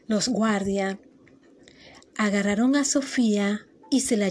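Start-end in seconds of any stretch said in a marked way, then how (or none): none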